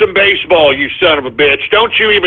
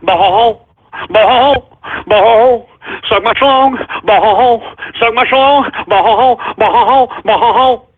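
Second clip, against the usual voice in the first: mocking chant. Caller mocks the host with a chant.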